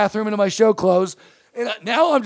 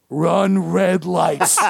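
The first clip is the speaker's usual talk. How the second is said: very slow, lower, deliberate command; slow deadpan order; 4 semitones lower, much slower than usual. Retold or imitated. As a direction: drag out each word as a cool bribe.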